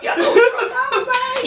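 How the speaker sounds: high, slow laughing question. Real, not acted. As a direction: laugh through the tease.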